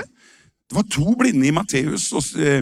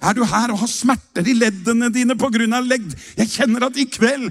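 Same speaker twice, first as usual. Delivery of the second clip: raised, half-sung healing proclamation; sing-song preacher's declaration; fervent preaching; 5 semitones higher than usual. Real, not acted. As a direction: preach it out over the music. Preaching at full force.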